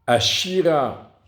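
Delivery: "Ashira" with SHI punched high and loud; a-SHI-ra, slowly, middle syllable drawn out; stress on SHI. Shown as a demonstration. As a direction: lean hard on the "shi" of "Ashira". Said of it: "l'accent tonique, il est sur le shin".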